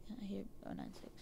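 fast hushed whisper. Real, not acted. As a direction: whisper so the monster won't hear. Hiding from monster.

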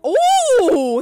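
high, loud excited ooh. Real, not acted. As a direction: gasp at the drama. Reacting to drama in game.

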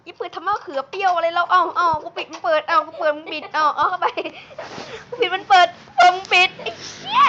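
frustrated shout at a teammate; angry yelling. Real, not acted. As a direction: yell at your friend for opening the door. Arguing over the door.

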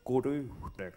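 lugubrious master of suspense; Hitchcock impression. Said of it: "Sir Alfred Hitchcock".

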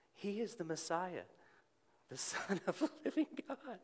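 high, formal recited answer to God; dutiful textbook answer. Retold or imitated. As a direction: say it the way you think you're supposed to. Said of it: "that's what I was supposed to say".

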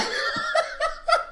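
high, quiet giggling laugh; real laugh. Real, not acted. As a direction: giggle at your own outburst. Laughs after own outburst.

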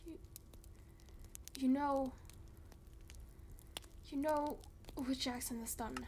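ordinary talk

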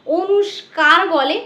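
অনুস্কার said slowly with a k sound, the mistaken form; slow demo of the wrong অনুস্কার; wrong pronunciation. Shown as a demonstration. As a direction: show the mispronounced অনুস্কার, drawn out. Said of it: "অনেকে এটাকে কি বলে?".

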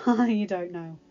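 bashful amusement, laughing it off; chuckling. A narrator's reading. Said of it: "Kit chuckled".

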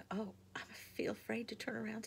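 high breathless whisper, scared; frightened whisper. A narrator's reading. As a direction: whisper it like you dare not look back. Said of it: "said Susan".

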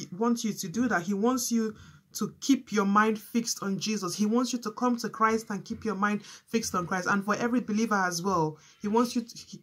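ordinary delivery

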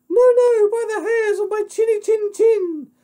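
very high, loud piggy squeak; little pig voice. Acted. Little pig refuses the wolf, high defiant squeal. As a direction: squeal the refusal in a tiny pig's falsetto.

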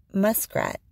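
ordinary delivery, nothing marked

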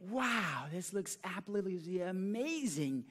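high, drawn-out awestruck exclamation; hammed-up amazement. Shown as a demonstration. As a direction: echo the wonder with theatrical relish. Partner echoes the improv direction.

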